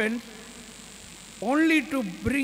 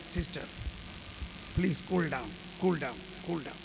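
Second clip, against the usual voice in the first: calming; 5 semitones lower, 9 dB quieter than usual. Retold or imitated. Calming the angry sister.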